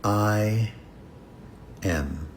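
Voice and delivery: each word alone, long pause between; slow, quiet affirmation. Shown as a demonstration. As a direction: say it slowly, as if to yourself. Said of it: "slowly say".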